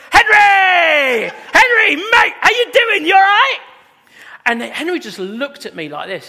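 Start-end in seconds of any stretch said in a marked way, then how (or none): 0.1-3.6 big, high-pitched shout; loud greeting. Retold or imitated. Pastor re-enacting hailing his friend in a car, shouted loudly. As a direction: bellow an over-hearty hello to a mate. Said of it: "I'm quite loud".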